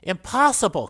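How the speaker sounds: high, loud cry of disbelief. A narrator's reading. Marshal disbelieves the dying man's report, crying out. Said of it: "cried the marshal".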